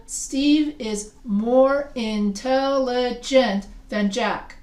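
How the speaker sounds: slow, with "intelligent" split into syllables; drawn-out teacher demonstration of "intelligent". Shown as a demonstration. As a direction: sound out "intelligent" beat by beat. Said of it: "more than one syllable".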